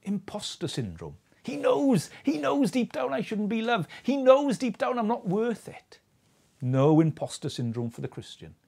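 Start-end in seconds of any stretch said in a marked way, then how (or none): 1.4-6.0 higher, fretful whisper-of-doubt voice, pitched up; anxious inner voice. Pretend. Preacher voicing the imposter's fear about God, anxious and higher. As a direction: voice the nagging fear that God sees through you.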